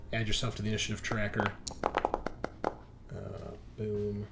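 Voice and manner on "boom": ordinary delivery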